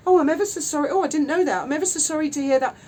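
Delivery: mimicking a rival's phony sympathy; mock sympathy. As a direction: mimic her insincere condolences. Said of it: "she goes".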